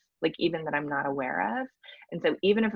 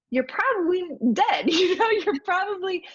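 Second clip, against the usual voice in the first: laughing; 13 semitones higher, 5 dB louder than usual. Real, not acted. Laughing at own joke about nerves.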